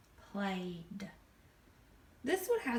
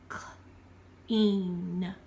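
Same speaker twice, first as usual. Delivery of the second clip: slow, stretched out; 3 semitones lower, 4 dB louder than usual. Shown as a demonstration. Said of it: "two vowels out walking".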